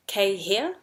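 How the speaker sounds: HERE swept upward at the end; posing a question. Shown as a demonstration. Teacher poses question in te reo, HERE rising. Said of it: "Posing the question".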